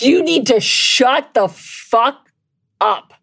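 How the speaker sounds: real anger. Real, not acted. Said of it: "I'm so fucking angry".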